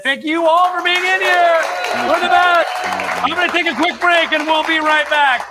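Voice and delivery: high, loud call to the crowd; shouted sign-off. Real, not acted. Host thanking the viewers before a quick break, excited shout. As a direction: holler it out to everyone watching.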